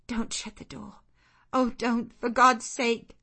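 woman begging the servant not to shut the door; frantic plea. Acted. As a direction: cry out as the door closes. Pleading at closing door.

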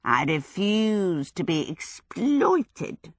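slow, sly demon refusing; wicked fire demon. A narrator's reading. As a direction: drag it out like a wicked flame spirit. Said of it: "He flickered back at her wickedly".

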